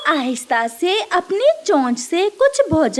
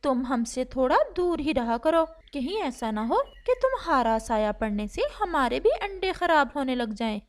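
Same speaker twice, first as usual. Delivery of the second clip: much softer, gentle storytelling voice; soft story narration; 3 semitones lower, 8 dB quieter than usual. A narrator's reading. A narrator softly tells a sad bird story, hushed. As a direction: read it softly like a bedtime tale.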